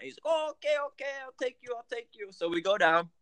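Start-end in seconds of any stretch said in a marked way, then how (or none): 0.3-2.3 high-pitched, eager local guy; mock the driver in a high voice, fading off; high imitation of an eager Uber driver. Uber driver quoted in story.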